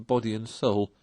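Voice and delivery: ordinary delivery, nothing marked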